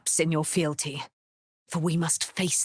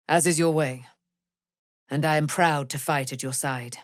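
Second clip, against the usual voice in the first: ordinary talk, nothing marked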